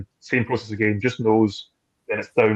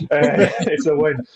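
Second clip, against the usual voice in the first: laughing; 5 semitones higher, 5 dB louder, slower than usual. Real, not acted. Laughing about Keith agreeing.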